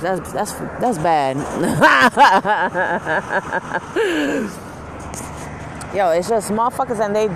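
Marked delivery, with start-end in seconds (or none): 1.4-5.9 loud laugh. Real, not acted. Laughs at herself.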